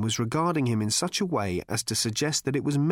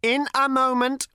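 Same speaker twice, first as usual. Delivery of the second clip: patient robot; 13 semitones higher, 5 dB louder than usual. A narrator's reading. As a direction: explain it with cold patience. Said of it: "repeated the robot patiently".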